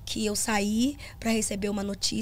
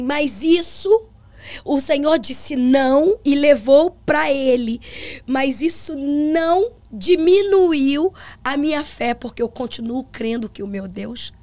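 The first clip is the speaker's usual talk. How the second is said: slow, louder preaching cadence; preaching to the church; 2 semitones higher, 6 dB louder, slower than usual. Retold or imitated. Pastor telling the congregation about her father, slow louder preaching. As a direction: proclaim it slowly from the pulpit.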